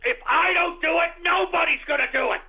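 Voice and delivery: impassioned shout. Real, not acted. Passionate rant climax.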